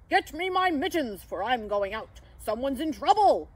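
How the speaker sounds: urgent big-voiced old gent calling out; Santa. A narrator's reading. Santa asks for mittens to go out, urgent cry. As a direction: cry it out like a character giving orders. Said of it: "I heard him cry".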